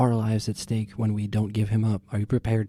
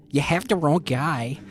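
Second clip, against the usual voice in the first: higher squeaky copy of Floon; mock Floon's squeak; mimicking Floon; 5 semitones higher than usual. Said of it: "mimicking Floon with changed face".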